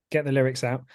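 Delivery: ordinary talk, nothing marked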